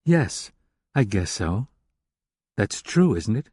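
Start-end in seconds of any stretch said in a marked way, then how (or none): none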